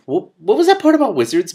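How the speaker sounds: high, loud sharp question; demanding. A narrator's reading. The king demands to hear about wizards, loud. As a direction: press him hard for the answer. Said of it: "Mendenbar demanded".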